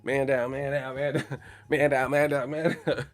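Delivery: half-laughing mock alarm, repeating man down; mock stunned shout. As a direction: play it like a fighter knocked flat, crying out. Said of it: "I was like".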